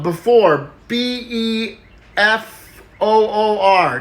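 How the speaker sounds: letters spaced out slowly; slow spelling. Acted. Timmy spells the word for the class, slowly.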